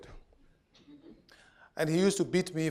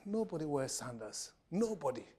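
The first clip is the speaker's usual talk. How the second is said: put-on soft child's protest, understated; quiet, lightly acted defence; subdued retold excuse; 11 dB quieter than usual. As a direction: mumble a small excuse to dad.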